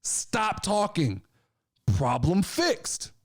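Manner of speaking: slow, deliberate dressing-down; telling off the locker room. Retold or imitated. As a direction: lay down the law to the wrestlers, slowly. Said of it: "I go".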